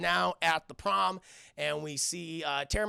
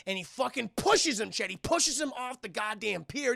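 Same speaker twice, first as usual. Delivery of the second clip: ordinary talk, nothing marked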